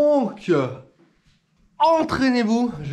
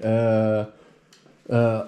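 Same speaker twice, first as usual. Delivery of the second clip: usual speaking voice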